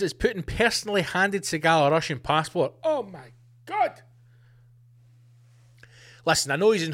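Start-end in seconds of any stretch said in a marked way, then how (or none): 2.8-4.0 shocked gasp. Real, not acted. Shocked at Putin handing passport.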